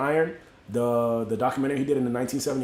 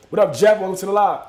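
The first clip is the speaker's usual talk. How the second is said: loud, raised-pitch shout-out; shouted greeting; 5 semitones higher, 8 dB louder than usual. Real, not acted. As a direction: break off to holler a quick welcome. Greeting the viewer joining the live again.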